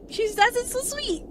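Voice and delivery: high, sing-song sweet quote; high mock-sweet voice; sweet quoting voice. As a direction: mimic a sugary little voice. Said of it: "Why [name] say it like that?".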